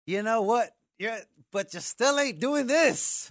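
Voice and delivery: high, slow, put-on nagging ex-wife voice. Ex-wife scolding him again and again, slow high nag.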